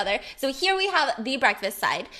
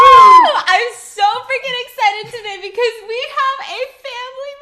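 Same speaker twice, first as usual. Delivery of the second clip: excited cheer; 10 semitones higher, 13 dB louder than usual. Real, not acted. Cheering, hyped intro.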